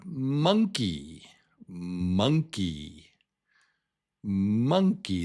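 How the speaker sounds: "monkey" with a long, strong first syllable; starts at the top. Shown as a demonstration. Teacher introduces another word, "monkey" stressed at the start. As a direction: come in strong on MON, let "monkey" fall. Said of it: "This starts at the top".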